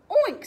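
high, loud piggy oink. Acted. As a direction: oink like a pig. Pigs oink in story.

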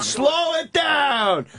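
drawn-out shouted command; slow shout. Real, not acted. Telling the guys to slow down, a slow drawn-out shout.

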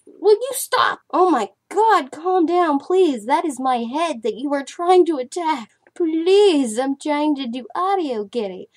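high, loud pleading scold; pleading with cat. Real, not acted. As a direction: beg the cat to calm down. Cat attacking her head.

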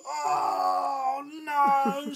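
high fed-up wail; exasperated dad. Acted. Sleepless dad at his wits' end, high wailing cry. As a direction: wail it like a worn-out father. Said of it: "said Dad".

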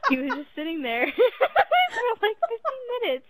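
high, breaking into laughter mid-sentence; laughing. Real, not acted. Telling friends about the waiting pizza guy, laughing. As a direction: struggle to talk through giggles. Storyteller cracking up at own tale.

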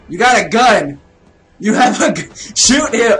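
loud, rising urgency; exasperated shout. Real, not acted. Gamer telling character to shoot, exasperated shouting. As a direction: yell instructions at the screen. Yelling advice at game character.

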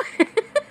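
high burst of laughter; a sudden high laugh. Real, not acted. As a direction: laugh out at the silly nickname. Laughing at her son's nickname.

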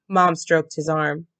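ordinary delivery

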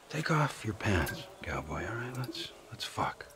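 menacing low taunt. Acted. Trevor taunting Johnny before attacking him, menacing and soft. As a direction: taunt him quietly like a predator.

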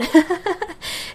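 high burst of laughter; real laugh. Real, not acted. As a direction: laugh at your own muddled explanation. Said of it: "Okay, I laughed there and I clipped".

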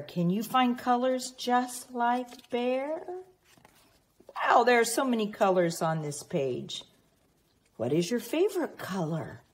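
plain reading voice

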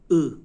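او alone, a short loud dull ı; dotless ı. Shown as a demonstration. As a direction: punch out the final vowel او of sarı by itself. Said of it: "آخرش اوه". (صدای کوتاه و بلند «او»)

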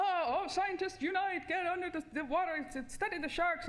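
high, eager rallying cry, slowed on the first words; hammed-up call to dive in after sharks; mock excited scientists. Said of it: "and we go".

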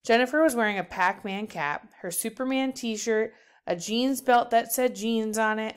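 ordinary delivery

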